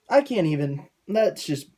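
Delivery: usual speaking voice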